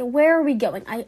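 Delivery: ordinary delivery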